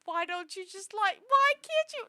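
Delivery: why can't you, demanded in real frustration; exasperated outburst. Real, not acted. Scolding people who won't comfort their friends, exasperated yell.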